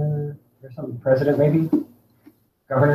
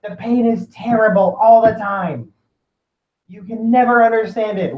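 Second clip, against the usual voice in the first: high, drawn-out complaint of pain; acted suffering client; 6 semitones higher, slower than usual. Sick client telling the consultant about constant pain, acted anguished complaint. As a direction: lament slowly, pitched up, as the misunderstood patient. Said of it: "say I'm the client".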